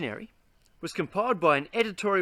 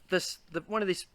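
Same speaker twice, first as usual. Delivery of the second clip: ordinary delivery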